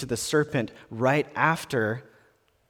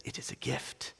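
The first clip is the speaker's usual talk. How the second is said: hushed whisper; 12 dB quieter than usual. Acted. Boromir urging use of ring.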